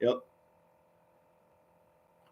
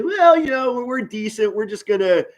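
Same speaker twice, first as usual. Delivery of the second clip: higher, lightly voiced doubtful fan; mock timid fan; 7 semitones higher than usual. Streamer mocking a fan who expects little, lightly voiced. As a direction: mimic a fan with no faith. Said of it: "You're not gonna say".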